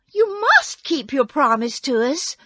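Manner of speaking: put-on high, loud girl's protest; exclaiming. A narrator's reading. Dorothy holding Oz to his promise, exclaiming. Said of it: "exclaimed Dorothy".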